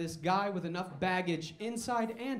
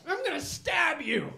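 put-on angry kid yell; 8 semitones higher, 7 dB louder than usual. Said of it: "And he's like".

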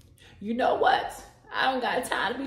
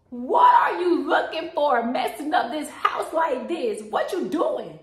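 loud scolding shout; angry shout; 5 dB louder than usual. Acted. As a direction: yell at the messy kid. Grandma finds messed up house.

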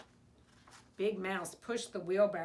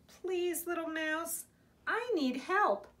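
higher-pitched plea; pleading; 6 semitones higher than usual. A narrator's reading. Big Mouse begging Little Mouse for help, pleading.

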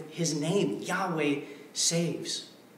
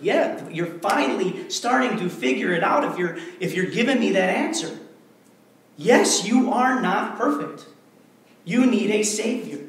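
louder, driving pulpit declaration; full-force preaching; 4 semitones higher, 6 dB louder than usual. Acted. Pastor hammering the point home to his congregation, forceful preaching.

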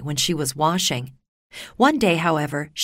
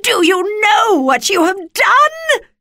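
very high, loud, drawn-out scream; witch screaming; 7 dB louder, slower than usual. A narrator's reading. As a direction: shriek it as the horrified wicked witch. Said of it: "The Wicked Witch screamed".

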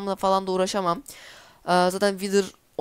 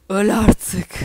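louder, fed-up yell at the boss; exasperated yell, just die already; frustrated shout; 7 dB louder than usual. Real, not acted. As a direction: shout it at the enemy, sick of the fight. Yelling at the Wither boss.

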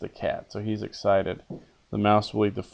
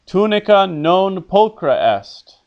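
loud, emphatic model line for the class to repeat; projected Latin model line; teacher's model reading; 8 semitones higher, 6 dB louder, slower than usual. Shown as a demonstration. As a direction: read it out clearly as the character, with feeling. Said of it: "I'll read what the character says".

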